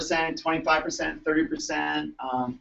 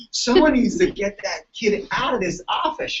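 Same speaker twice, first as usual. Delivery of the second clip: higher, slow exasperated order; annoyed demand; 7 semitones higher, 4 dB louder than usual. Retold or imitated. Chiropractor on the phone ordering the kid out, exasperated. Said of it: "I'm like".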